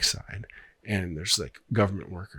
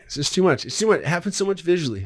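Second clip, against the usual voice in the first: higher, louder, flustered; real overwhelmed frustration; 9 semitones higher, 5 dB louder than usual. Real, not acted. Storyteller losing the thread of a visual script, flustered. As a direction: blurt it out, climbing in pitch.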